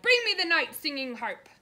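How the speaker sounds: loud booming order; giant's command. A narrator's reading. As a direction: bark it like a greedy giant. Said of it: "he cried".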